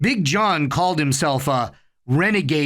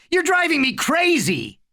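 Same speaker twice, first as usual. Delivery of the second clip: yell; 13 semitones higher than usual. A narrator's reading. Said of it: "Harry yelled".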